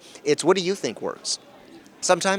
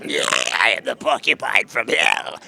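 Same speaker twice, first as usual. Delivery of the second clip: a hint of a low hellish creature; lightly voiced demon; demonic porcupine; 4 semitones lower than usual. Shown as a demonstration. Voice actor demoing a hellish porcupine, lightly growled. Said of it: "Maybe more like".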